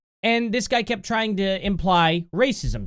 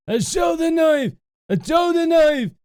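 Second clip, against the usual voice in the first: shouted, higher fake Scottish accent; mock Scottish accent; 9 semitones higher, 5 dB louder than usual. Mocking the man from the clip, loud fake Scottish accent. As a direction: bellow it in a sarcastic Scottish put-on. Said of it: "sounds like a Scottish guy right there".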